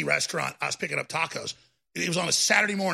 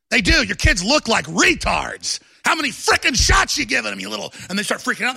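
usual speaking voice

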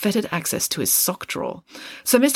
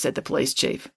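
usual speaking voice

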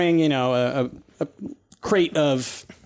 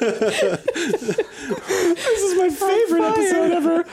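laughing; 14 semitones higher, much slower than usual. Real, not acted. GM delighted by the joke.

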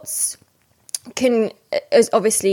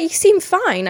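high, louder quoted line; imitating sister; 10 semitones higher, 4 dB louder, slower than usual. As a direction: mimic the sister's light tone. Sister remarks she seems fine.

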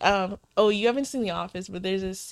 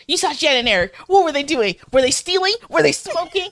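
increasingly high, insistent questioning; playful, badgering retold grilling; mock interrogation; 5 semitones higher, 3 dB louder than usual. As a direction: fire off questions, climbing higher each time. Acting out the boss interrogating employee.

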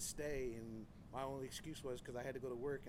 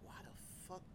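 breathy whisper of disbelief; whispered why the fuck; 5 semitones higher, 9 dB quieter, faster than usual. Retold or imitated. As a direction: hiss it under your breath in dismay. Exasperated aside in own rant.

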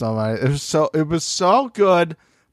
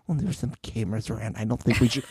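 quiet hesitant; 4 semitones lower, 8 dB quieter, faster than usual. Pretend. Timid excuse in acted scene.

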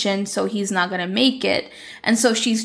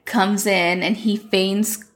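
ordinary delivery

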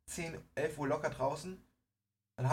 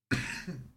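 dry coughing bout; coughing fit. Real, not acted. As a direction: cough hard a few times. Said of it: "Ich muss gerade meinen Hustenanfall".

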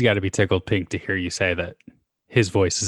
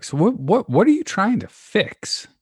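imitated therapist, high-pitched and louder; retold challenge; 13 semitones higher, 5 dB louder than usual. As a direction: call them out with a raised, incredulous question. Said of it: "she called me out one day".

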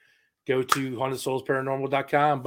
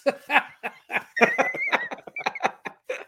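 laughing. Real, not acted. Blouse joke lands.

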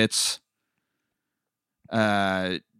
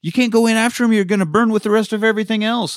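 high, pleading mock alarm, building louder; urgent warning; 10 semitones higher, 4 dB louder than usual. Retelling Tim stopping Brian from entering the cave, high urgent plea. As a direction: warn them off like it's life or death. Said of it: "Tim's like".

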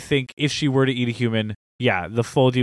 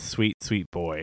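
doting; 7 semitones lower, 8 dB quieter, slower than usual. Acted. Doting on sweet character.

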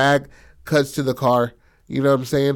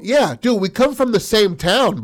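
higher-pitched acted appeal; insistent protest; 8 semitones higher than usual. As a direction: plead familiarity with an old acquaintance. Acting out insisting on old acquaintance.

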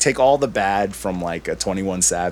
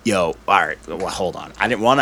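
ordinary talk, nothing marked

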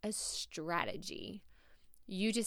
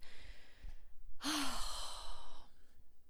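exasperated sigh; 6 semitones higher, 5 dB quieter than usual. Real, not acted. Sigh after flubbed phrase.

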